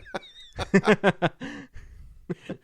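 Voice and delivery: a big burst of laughter; high, loud laughing burst. Real, not acted. Laughs at South Park joke.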